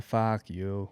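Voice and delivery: put-on gruff South Boston accent; Southie Boston accent. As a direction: spit it out like a Southie local. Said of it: "Southie's a little bit more like".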